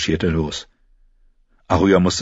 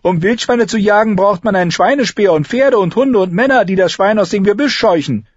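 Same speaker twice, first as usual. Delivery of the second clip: high, louder, know-it-all lecture; lecturing Arya; 14 semitones higher, 5 dB louder than usual. A narrator's reading. Arya lectures Lommi on boar hunting, know-it-all. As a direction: set him straight. Arya's character voice.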